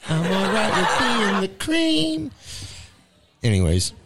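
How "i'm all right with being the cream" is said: much higher and slow, laughing through it; silly high voice. Shown as a demonstration. Joking to cohost about being the sandwich cream, in a silly high voice. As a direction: stretch it out in a goofy falsetto.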